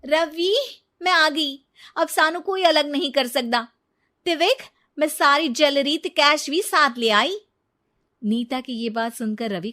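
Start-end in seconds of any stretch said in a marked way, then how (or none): 0.1-7.4 loud, high, eager announcement; excited arrival. Acted. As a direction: burst in thrilled with the loot.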